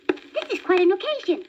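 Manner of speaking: bright high bird chatter; magpie voice. A narrator's reading. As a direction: play the chirpy magpie. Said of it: "he said".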